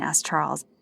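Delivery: usual speaking voice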